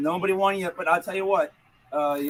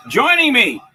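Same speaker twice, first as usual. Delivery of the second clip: high, loud, stretched-out announcer call; announcer intro; 9 semitones higher, 10 dB louder, slower than usual. Acted. Host introducing a guest, big announcer voice.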